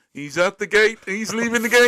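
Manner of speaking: excited racecourse commentator at full volume; horse race commentator. Retold or imitated. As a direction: call the race like the horses are breaking from the gate. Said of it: "this obsession with racing".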